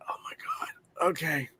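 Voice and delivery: whispered, slow retold "oh no" reaction; soft, stunned aside; hushed dismay. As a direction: whisper it under your breath, sinking in. Retold dismayed reaction during the movie.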